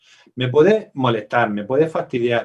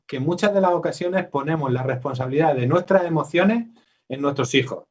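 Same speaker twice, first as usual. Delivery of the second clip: usual speaking voice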